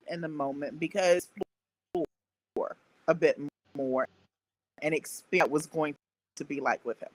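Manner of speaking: usual speaking voice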